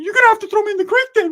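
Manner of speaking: high, fast, loud retort; defiant yell. Retold or imitated. Ricky refusing to hand over sodas, high defiant yell. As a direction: fire back defiantly in a high voice. Ricky defies the robber's threat.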